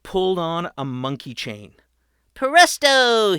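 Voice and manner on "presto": high, loud magician's cry; triumphant shout. A narrator's reading. Patrick pulling the monkey chain, loud and triumphant. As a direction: announce it like a trick. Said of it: "he shouted".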